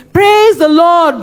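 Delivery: high, loud, slow church cry; slow, high praise shout; dramatic shout. Pretend. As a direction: proclaim it slowly to the congregation. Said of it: "I see myself shouting".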